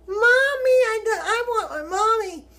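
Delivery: high, loud, tearful mock cry; hammed-up whine of a frightened little kid; mock childlike wail. Woman retells wanting mommy after finding the hill, mock wailing. Retold childlike panic calling mother.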